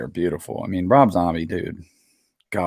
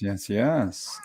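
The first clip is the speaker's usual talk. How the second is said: ordinary delivery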